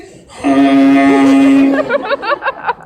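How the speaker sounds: loud, low, drawn-out honk; sustained air horn blast by mouth; imitated air horn. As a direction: let out one long horn blare. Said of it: "It happens again".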